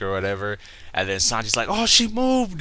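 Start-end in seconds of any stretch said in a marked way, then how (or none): 1.7-2.6 very high, hammed-up gasp of delight; mock smitten outburst. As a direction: squeal it in imitation of a lovestruck man. Sanji's smitten reaction retold.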